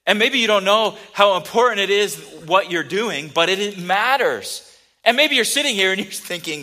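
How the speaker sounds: loud, rising preacher's push; preaching at full force. Acted. Pastor urging the congregation, loud preaching.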